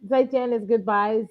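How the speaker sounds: ordinary talk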